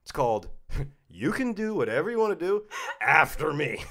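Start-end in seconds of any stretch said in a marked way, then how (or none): 1.1-3.8 high, loud mock defiance; defiant. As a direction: declare it as a comic new motto, pitched up. Announcing his new assertive motto.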